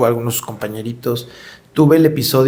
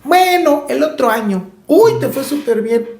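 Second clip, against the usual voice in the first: mock backhanded praise; 11 semitones higher than usual. Imitating someone's backhanded praise.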